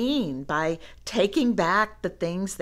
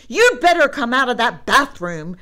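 loud, higher shout through a door; 5 semitones higher, 10 dB louder, faster than usual. A narrator's reading. Brother banging on bathroom door, shouting. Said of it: "shouted Brother".

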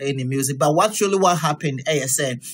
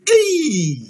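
mock shock; 12 semitones higher than usual. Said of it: "Ahumensi say".

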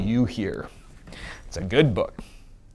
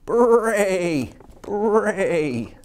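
high mock donkey bray; 8 semitones higher, 4 dB louder than usual. Shown as a demonstration. Teacher showing the children what braying sounds like, high mock bray. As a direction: bray it out like a zebra. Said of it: "Braying is like".

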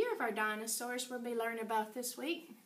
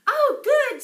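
much higher and louder, delighted; excited boy; 12 semitones higher, 13 dB louder than usual. Acted. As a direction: play Danny thrilled. Danny voiced excitedly.

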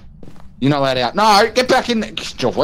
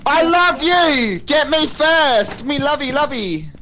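high, slow, hammed-up love calls; high mock adoring cry; 10 semitones higher, slower than usual. Mocking the hunting ghost with a high, slow, hammed-up love call. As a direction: coo at the ghost like a lovesick fool. Gushing at ghost chasing them.

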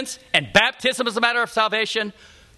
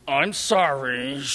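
grudging sorry; 7 semitones lower, much slower than usual. Retold or imitated. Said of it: "That's not good enough".

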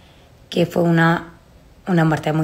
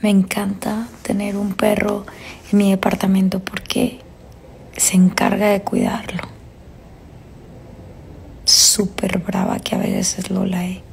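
whispering. Real, not acted. Whispering so the dog won't hear.

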